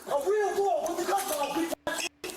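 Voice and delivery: high, heated bragging at captors; defiant boasting. Real, not acted. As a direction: boast angrily with the pitch pushed up. Suspect taunting officers while held down.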